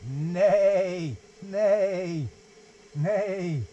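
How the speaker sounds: a light, loud horse neigh, falling each time; playful mock neigh. Dad neighs for the dog like the book's horse, playful. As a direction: neigh like a silly jazzy horse. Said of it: "give a very jazzy neigh".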